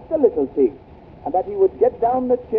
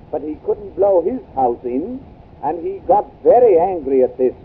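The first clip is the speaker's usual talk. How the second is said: ordinary talk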